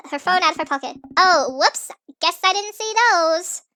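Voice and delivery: ordinary talk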